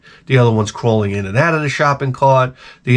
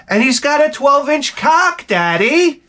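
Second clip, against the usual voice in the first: high, slow girly mock voice; sweetly teasing daughter to daddy; mock teenage daughter; slower than usual.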